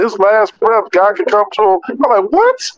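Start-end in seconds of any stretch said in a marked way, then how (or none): 2.3-2.7 very high incredulous yelp; acted, high-pitched startled question; retold shock. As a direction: squeak it out in mock disbelief. Acts own shocked reaction to answer.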